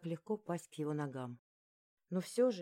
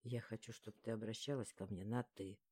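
low, hushed man's voice; intimate male lead; 7 semitones lower, 7 dB quieter than usual. A narrator's reading. He asks her to speak informally, low soft male voice. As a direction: drop low and soft for his request.

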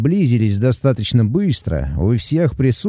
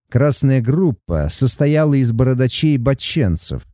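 ordinary delivery

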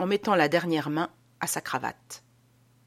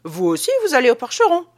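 suspicious question; 6 semitones higher, 7 dB louder, faster than usual. Acted. Théophraste questions Petitot suspiciously.

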